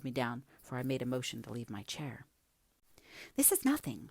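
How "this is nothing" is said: much higher protesting cry. A narrator's reading. Young woman waving off the narrator's interruption, crying out. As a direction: exclaim it high, brushing the objection aside. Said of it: "she cried".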